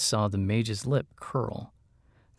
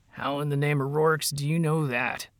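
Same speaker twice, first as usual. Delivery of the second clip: mildly growled, higher-pitched demand; 5 semitones higher than usual. A narrator's reading. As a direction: demand an answer with a touch of growl. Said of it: "Flint growled".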